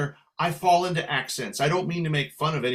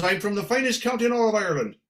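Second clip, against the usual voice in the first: quick, higher Irish brogue; Irish lady's brogue; 5 semitones higher, faster than usual. Retold or imitated. Little lady bragging about her county, quick Irish brogue. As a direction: boast in a brisk Irish lilt. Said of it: "She goes".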